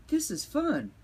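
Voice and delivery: put-on high squeaky little girl; chirpy kitten sister. A narrator's reading.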